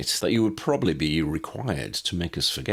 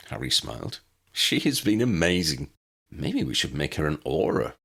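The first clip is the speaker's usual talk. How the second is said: ordinary delivery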